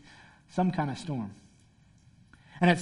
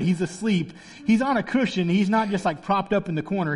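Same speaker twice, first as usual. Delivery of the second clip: usual speaking voice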